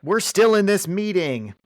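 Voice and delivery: high-pitched imitation of an affronted executive; mock indignant surprise. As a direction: object like a scandalized businessman. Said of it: "they're, like, surprised".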